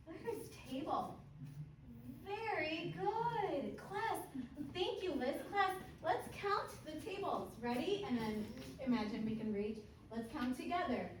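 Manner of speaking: slow, high, loud and beaming; over-enunciate for beginner English learners; kids show host. Shown as a demonstration. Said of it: "But I want to do one with you".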